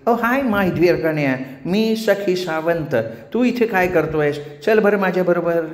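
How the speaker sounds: high, slow, gushing flirt. Acted. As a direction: drawl a coquettish celebrity greeting. Voices Sakhi Sawant. (चढ्या आवाजातली नखरेल सुंदरी)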